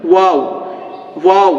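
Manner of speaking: ওয়াও with rounded lips and a gap between them; between both lips. Shown as a demonstration. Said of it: "দুই ঠোঁটের মাঝখান থেকে ওয়াও উচ্চারণ হবে". (দুই ঠোঁটের মাঝখান থেকে ওয়াও)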